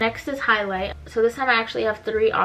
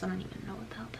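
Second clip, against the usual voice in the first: whispered aside; hushed confusion; 14 dB quieter, much faster than usual. Real, not acted. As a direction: mutter it under breath in disbelief. Reading strange bio.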